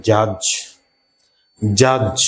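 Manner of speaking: জাজ said as "jaj", no d sound; teacher demo of a silent d. Shown as a demonstration. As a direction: model জাজ. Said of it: "আমরা এটাকে উচ্চারণ করব".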